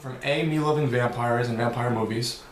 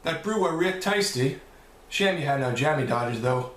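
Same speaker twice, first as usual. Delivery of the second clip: British accent; 2 semitones higher than usual. Shown as a demonstration. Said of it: "say something in my best British accent".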